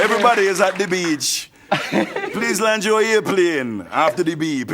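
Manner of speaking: easygoing Jamaican recorded greeting; Jamaican answering machine. Acted. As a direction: recite a Jamaican voicemail message. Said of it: "Jamaica Air Traffic Control is an answering machine".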